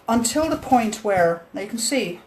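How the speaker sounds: usual speaking voice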